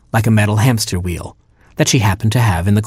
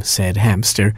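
usual speaking voice

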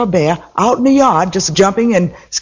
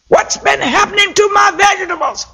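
high, furious shout; angry Mama Bear shout; 13 semitones higher than usual. A narrator's reading. Mama Bear shouting at her daughter about the missing vegetables. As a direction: demand an answer at the top of your voice.

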